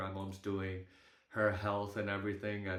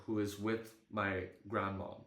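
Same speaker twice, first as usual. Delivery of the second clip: ordinary talk, nothing marked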